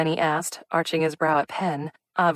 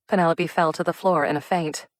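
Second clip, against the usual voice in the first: plain reading voice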